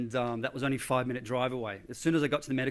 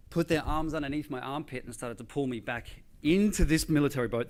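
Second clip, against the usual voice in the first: usual speaking voice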